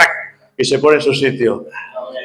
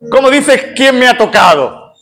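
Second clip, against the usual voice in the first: disciples objecting; 6 semitones higher than usual. Retold or imitated. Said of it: "los discípulos que estaban alrededor dicen".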